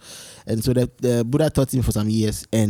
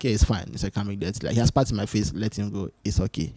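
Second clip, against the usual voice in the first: softer, hushed reassurance; calm Buddha; 6 semitones lower, 8 dB quieter than usual. Retold or imitated. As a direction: let it go, gently and quietly. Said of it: "So it's like".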